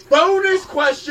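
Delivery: slow, loud and high; loud announcement. Acted. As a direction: call it out like a game show host. Host announces bonus round.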